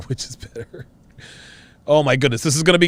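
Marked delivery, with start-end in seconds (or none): 0.1-0.9 whispered aside. Real, not acted. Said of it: "whispered repeat after laugh".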